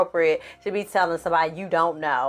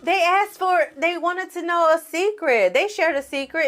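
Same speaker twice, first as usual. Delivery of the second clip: put-on higher, hesitant girl explaining herself; flustered excuse; 9 semitones higher than usual. Z caught texting, lightly voiced flustered excuse. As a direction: stammer it out, caught red-handed.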